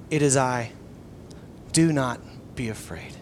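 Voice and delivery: soft, low, near-whispered comfort; gentle hush, solemn and low; hushed reassurance. Retold or imitated. Preacher voicing Jesus reassuring frightened disciples.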